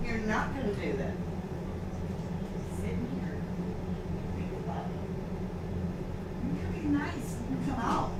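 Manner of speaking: whisper, barely there; whispering gently to the dog in timeout. Real, not acted. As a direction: hush it as you shut the dog in.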